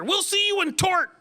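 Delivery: high mocking lawyer voice; grown daughter mocked. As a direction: jab it like a smug high-powered lawyer. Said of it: "if she's thirty-five and a high-powered lawyer".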